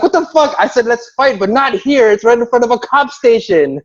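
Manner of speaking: high-pitched, heated retelling of an objection; imitated angry protest. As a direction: argue back as the riled-up friend. Acted angry protest during fight.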